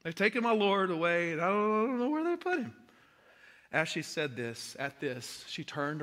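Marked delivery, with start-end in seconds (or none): 0.0-2.7 louder and higher, pleading in distress; rising anguish as she speaks; distraught Mary. A narrator's reading. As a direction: cry it out like a lost mourner. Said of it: "She's writing in her concrete".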